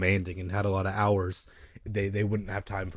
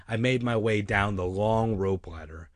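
usual speaking voice

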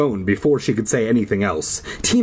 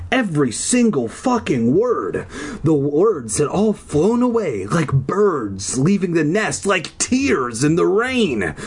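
slow, higher, overwrought narration; drawn-out theatrical lament; mock-dramatic; 5 semitones higher, slower than usual. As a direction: stretch it into tragic poetry. Mock-dramatic narration of forgotten lines.

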